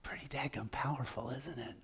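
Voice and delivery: a hushed, breathy aside to the room; whisper. Real, not acted. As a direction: lean in and whisper it, in awe.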